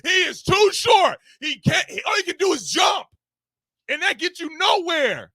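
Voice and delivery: loud, high, heated rant; yelling. Real, not acted. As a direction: shout it out in frustration. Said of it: "you already done yelled".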